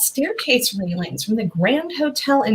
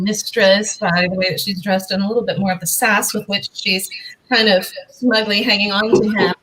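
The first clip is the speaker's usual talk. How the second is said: normal talking voice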